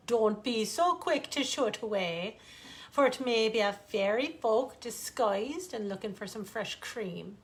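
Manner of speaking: ordinary delivery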